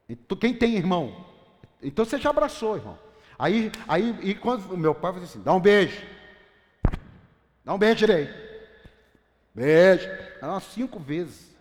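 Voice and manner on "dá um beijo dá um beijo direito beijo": loud commanding dad voice; father's stern order. Retold or imitated. Father making the quarrelling kids kiss, loud commanding tone.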